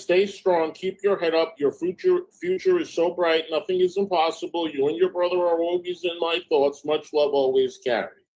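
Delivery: high, even read-out voice; reading a message aloud. Acted. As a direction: read it off the screen, pitched well up.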